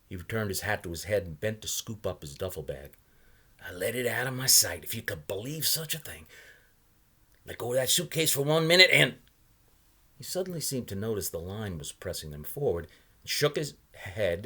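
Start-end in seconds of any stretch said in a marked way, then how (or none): 3.6-9.2 put-on a higher, louder voice for the odd old salesman; old man pitching his wares, higher and louder. A narrator's reading. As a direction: play the chatty old knot peddler. Salesman's voice in read story.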